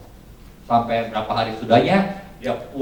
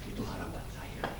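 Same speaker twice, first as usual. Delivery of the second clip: normal talking voice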